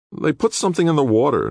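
high-pitched, indignant reply; angry. A narrator's reading. Nick answering the attorney's accusation, angrily. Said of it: "Nick replied angrily".

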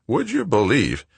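high-pitched exasperated mechanic; exasperated Wojo. A narrator's reading. Wojo's figure voice, cursing the tractor.

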